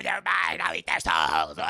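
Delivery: villain shout. Retold or imitated. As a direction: roar it like a soul-eating demon. End of a villain quote, shouted.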